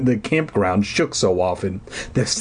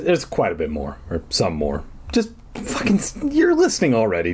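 usual speaking voice

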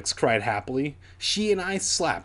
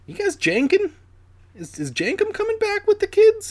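very high mock squeal; silly aside; 4 dB louder than usual. Real, not acted. Narrator riffing on a name, squeaky high joke.

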